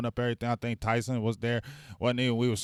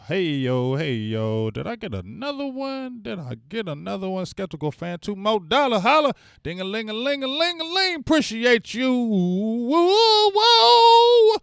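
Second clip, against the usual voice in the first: high sing-song hype, building to a loud woo; over-the-top high-pitched thank-you; hype shout-out; 11 semitones higher, 7 dB louder than usual. Pretend. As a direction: celebrate a fan's support, pitch soaring into whoops. Hype shout-out to a fan who chipped in.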